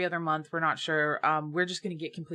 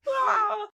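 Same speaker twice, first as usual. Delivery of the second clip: frustrated shriek; 5 dB louder than usual. Real, not acted. Frustrated outburst.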